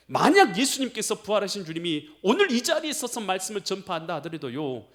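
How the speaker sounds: normal talking voice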